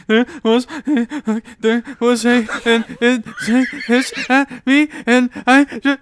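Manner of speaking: high, loud, slowed sobbing voice; mimicked panicked crying. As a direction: imitate a frantic tearful phone call. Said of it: "she's just bawling".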